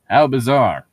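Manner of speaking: loud, punchy How bizarre with the stress on "How"; mock exclaim. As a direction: belt "How bizarre" out like a sung hook. Mock reading, song reference.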